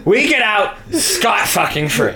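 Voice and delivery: usual speaking voice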